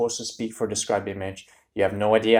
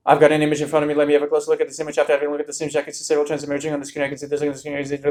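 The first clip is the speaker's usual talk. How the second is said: higher, fast rattled-off template answer; memorized nonstop answer; 5 semitones higher, 4 dB louder, much faster than usual. Shown as a demonstration. Tutor imitating a student reciting a memorized template, breathless rush. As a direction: race through the memorized script without breathing. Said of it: "but you're doing this".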